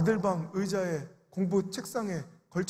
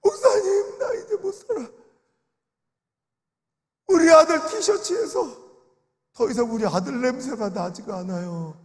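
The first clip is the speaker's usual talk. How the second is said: high, crying shout that fades into tears; retold sobbing mother's cry; 4 semitones higher, 5 dB louder than usual. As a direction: sob out the loss of her son's scent. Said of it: "하면서 울더라고요".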